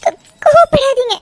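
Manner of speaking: tearful, breaking voice; crying reassurance. Acted. Wife comforting her husband through tears. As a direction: comfort him while crying.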